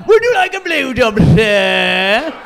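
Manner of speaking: high, toothy posh English offer; a prim English girl offering; toothy English accent. Pretend. Mocking an English girl offering a blowjob, in a high toothy English accent.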